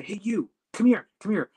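high-pitched summons; imitated insistent "come over" call; retold calling over. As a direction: mimic beckoning someone over, pitched high. Ladies calling airport workers over.

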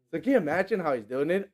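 ordinary talk

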